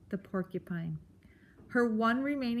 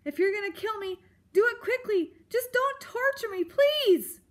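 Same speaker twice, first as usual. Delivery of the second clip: very high mouse plea, building louder; tearful begging; 12 semitones higher, 5 dB louder than usual. A narrator's reading. Tearful mouse begging not to be tortured, pleading. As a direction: plead frantically up to a loud last word. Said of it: "Poppy gazed up tearfully".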